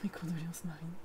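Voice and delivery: soft whispered condolences. Real, not acted. Streamer whispering condolences to viewer Marine, unvoiced. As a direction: breathe the words, no voice.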